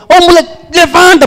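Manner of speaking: high, loud wake-up call. Acted. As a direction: shout him awake. Father calling son to wake.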